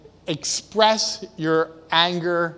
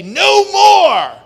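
high, loud shout of refusal; acted defiant cry; 13 semitones higher, 11 dB louder than usual. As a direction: yell it like you're finally standing your ground. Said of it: "put your foot down and say".

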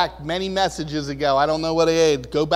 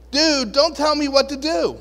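higher, firm dismissive retort; brush-off; 8 semitones higher, 4 dB louder than usual. Retold or imitated. Jesus snapping back at Peter, firm brush-off. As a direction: shut the friend down.

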